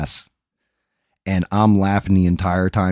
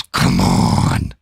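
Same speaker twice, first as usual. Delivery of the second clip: much lower, louder dare from the huge guy; big guy's challenge; 12 semitones lower, 5 dB louder than usual. Retold or imitated. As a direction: drop low and dare him to keep going. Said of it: "looks at me and goes".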